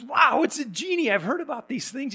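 high, amazed wow at the genie; excited man. Retold or imitated. Man on the beach gasps at the genie, high and excited. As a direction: gush like you just found a genie.

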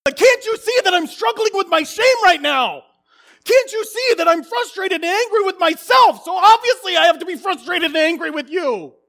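put-on high, loud, exasperated shouting at the kids; angry dad rant. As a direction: vent the frustration on them, full force. Voicing his angry self at kids.

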